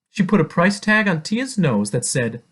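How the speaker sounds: ordinary delivery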